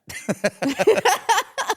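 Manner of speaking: high burst of laughter; real laugh. Real, not acted. Laughing after the cheese enforcers joke, high-pitched. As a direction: crack up mid-thought.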